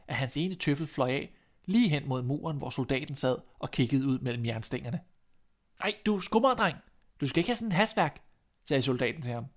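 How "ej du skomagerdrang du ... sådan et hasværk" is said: raised, higher call through the prison bars, quicker at the end; soldier calling out. A narrator's reading. Soldier in jail hails the shoemaker's boy, calling out higher. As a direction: call out eagerly to the passing boy.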